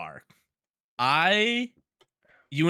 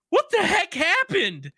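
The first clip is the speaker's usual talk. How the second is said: high, drawn-out mock disbelief; baffled outburst; slower than usual. Host reenacting his reaction to the show, high baffled disbelief. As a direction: stretch it out high, acting out bewilderment.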